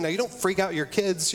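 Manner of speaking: ordinary talk, nothing marked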